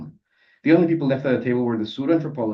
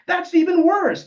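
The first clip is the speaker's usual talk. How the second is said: very high, loud indignation; outraged outburst; 7 dB louder, slower than usual. Acted. Decanonizer rebuking the narrator, outraged. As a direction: protest at the top of your range.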